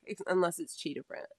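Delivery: ordinary talk, nothing marked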